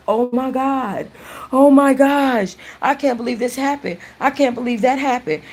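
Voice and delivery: stretched-out, high-pitched gasping disbelief; shocked disbelief. Acted. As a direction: play a stunned reader, pitched well up. Imagined reader reacting to the book.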